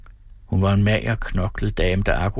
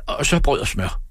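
higher-pitched, mildly bossy order; commanding; 7 semitones higher than usual. A narrator's reading. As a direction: add a brisk little command. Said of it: "kommanderede Peter". (let kommanderende)

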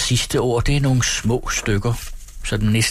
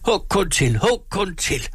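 high, strained-up cry without volume; frantic cry; 10 semitones higher than usual. A narrator's reading. Peasant daring his captor to hit him, frantic cry. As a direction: goad him wildly in a high pitch. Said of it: "skreg bonden ud af sig selv".

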